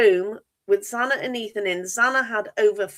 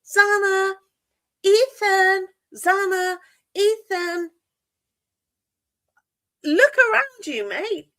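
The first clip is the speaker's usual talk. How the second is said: imitating a man calling out high and loud; mock-call the names out, much higher and louder; imitated shouting; 10 semitones higher, 4 dB louder, slower than usual. Host imitating Hunter calling out names, mock shouting. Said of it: "you can hear him going".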